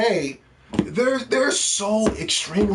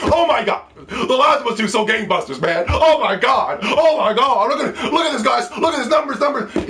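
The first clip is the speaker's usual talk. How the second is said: hammed-up hype, higher and louder; mock excitement; 5 semitones higher, 3 dB louder than usual. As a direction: shout it like a gloating fan. Mocking fans bragging about sales.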